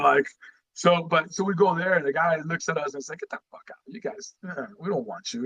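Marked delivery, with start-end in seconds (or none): 3.2-5.4 hushed, near-whispered imitation of the recruiter; lightly voiced stern recruiter, pushed way down in volume; mock recruiter brush-off. Recruiter telling drunk teens to get out, hushed mock voice.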